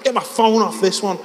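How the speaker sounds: put-on high, insistent Scouse accent; defiant Scouse. Lad insisting to his mates, high stubborn Scouse. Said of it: "give you a glimpse of the scouse".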